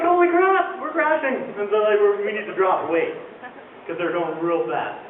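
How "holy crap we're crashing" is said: high-pitched mock panic; panicked pilot. Pilot to everyone aboard as the plane crashes, mock panic. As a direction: yelp it high, like an alarmed pilot.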